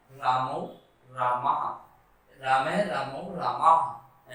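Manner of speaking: रामौ रामाः chanted low and slow; recited declension. Shown as a demonstration. Teacher reciting how the forms are said. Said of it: "ऐसे बोलना है".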